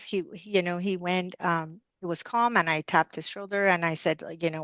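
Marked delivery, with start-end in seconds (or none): none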